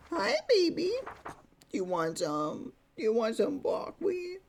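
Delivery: high, cooing pet voice. Real, not acted. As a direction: coo at a pet while offering a treat. Baby talk to pet Lucy.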